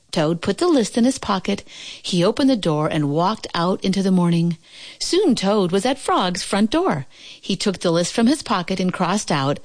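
ordinary delivery, nothing marked

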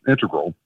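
integral said IN-te-gral; stress on first syllable. Retold or imitated. Caller repeating how his daughter says it, integral as IN-te-gral. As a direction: hit IN at the start of integral. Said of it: "she said she pronounces it".

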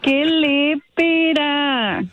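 very slow, drawn-out reproach; real scandalized disapproval; scandalized scolding. Real, not acted. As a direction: stretch each insult out in disgust. Scolds the rude caller.

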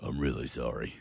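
very low, soft Aussie tradie voice. Retold or imitated. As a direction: drop right down into a blokey Aussie tradie. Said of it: "Like as if your voice isn't deep already".

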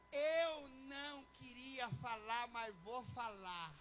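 very slow, drawn-out mock reluctance; exaggeratedly laboured delivery; reluctant announcement. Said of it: "É bem assim, ó".

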